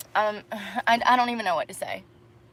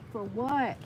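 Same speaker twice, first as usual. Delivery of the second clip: much softer, falling "For what?"; muttered repeat; 14 dB quieter than usual. Real, not acted. Dancer repeating her grievance to herself, soft and bitter. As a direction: let "For what?" sink to a bitter murmur.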